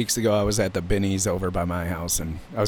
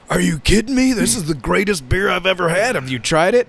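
much higher, loud and thrilled; excited fan; 8 semitones higher, 3 dB louder than usual. Retold or imitated. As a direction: play the fan who can't believe his luck. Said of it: "He was so excited".

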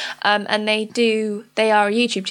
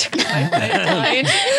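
laugh; 10 semitones higher than usual. Real, not acted. Laugh before announcing.

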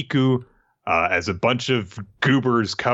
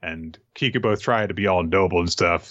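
ordinary talk

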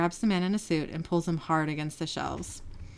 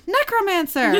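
excited shout; 13 semitones higher, 9 dB louder than usual. Acted. Narrator voicing Sam's outburst.

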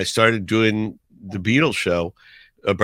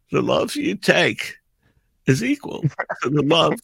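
imitating Walken; 7 semitones higher than usual. Walken imitated, tearful and slow.

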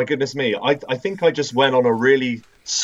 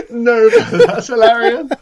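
squeaky laugh through words; 11 semitones higher, 8 dB louder, slower than usual. Real, not acted. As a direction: laugh it out, barely holding on. Cracking up at a joke.

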